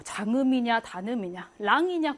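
usual speaking voice

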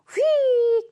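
high, loud, stretched-out whisk; swooping sound-effect word; whoosh-like flick. Shown as a demonstration. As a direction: draw 휙 out high like something flying off. Acting out the letter flying off.

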